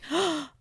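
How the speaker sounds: sharp high gasp of awe, breath dropping away; a gasp as the stands come into view; awed gasp. Retold or imitated. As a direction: catch your breath at the sight. Overwhelmed seeing the stadium.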